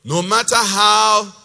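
"how" very loud and long drawn out; stress on HOW. Acted. Preacher showing what people emphasize in prayer, "how" loud and stretched. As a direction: lean hard on "how" and hold it. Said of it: "is that what you hear people emphasize".

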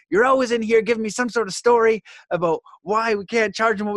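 mock telling-off, pitched well up; upset boss. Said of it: "And he's so upset".

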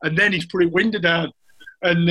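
ordinary talk

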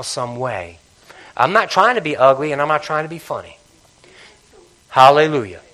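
loud pulpit voice driving each not; forceful preaching rebuke; full-force preaching. Acted. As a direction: press the congregation hard.

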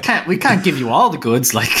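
words bubbling through laughter; real giggling reply; laughing. Real, not acted. Joking about the wrestler's backstory.

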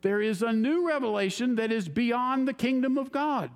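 a fellow raising his pitch with eager excitement; excited fellow. Retold or imitated. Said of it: "this guy was just all excited".

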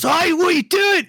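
put-on high-pitched yell in a rough Irish accent; attempted Irish accent. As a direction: holler like a riled-up Irishman. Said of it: "this Irish dude goes".

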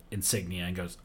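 ordinary delivery

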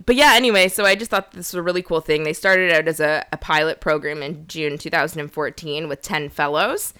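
ordinary talk

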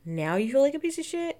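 rising incredulous scoff. Real, not acted. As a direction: throw it back, indignant and high. Indignant retort at Carrie.

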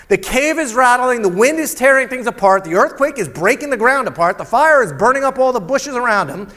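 loud, driving sermon voice; full-force preaching. Acted. Preaches the storm scene loudly.

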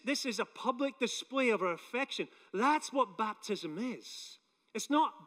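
normal talking voice

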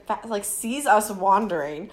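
usual speaking voice

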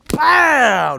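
loud high punchy pow; shouted collision sound; crash sound effect. Retold or imitated. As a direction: slam out the impact noise. Acting out the crash.

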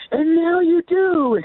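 high, loud, drawn-out re-enacted cry; jubilant welcome. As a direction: stretch it out like an open-armed welcome. Acts out open-armed greeting.

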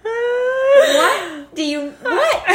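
high, drawn-out laughing cry; disbelieving laugh-cry. Real, not acted. Mom cracking up retelling the car disaster. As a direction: stretch it out through laughter.

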